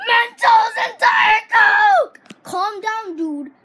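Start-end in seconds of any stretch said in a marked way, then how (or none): 0.1-2.2 loud, slow, stretched out yell; shouted product name. Acted. Kid yells his product name to viewers, loud and slow.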